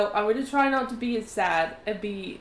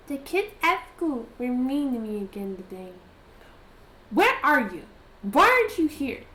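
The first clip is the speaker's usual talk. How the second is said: put-on higher, louder pleading little girl; lonely girl's letter voice; 7 semitones higher, 6 dB louder than usual. Letter voice of a lonely girl missing her friend, pleading. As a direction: read it as a lonely ten-year-old calling out. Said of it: "it probably says something along the lines of".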